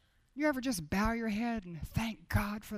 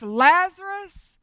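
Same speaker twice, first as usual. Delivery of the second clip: loud, slow, higher call; 7 semitones higher, 14 dB louder, much slower than usual. Acted. Jesus calling Lazarus out of the tomb, loud call. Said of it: "he called out in a loud voice".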